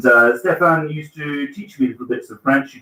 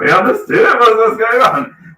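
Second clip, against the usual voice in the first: baffled audience; 11 semitones higher, 6 dB louder than usual. Retold or imitated. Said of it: "everyone in the audience is turning around going".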